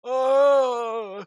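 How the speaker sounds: loud, high undead howl; zombie roar. Pretend. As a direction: let out a loud zombie wail. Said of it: "my zombie noise".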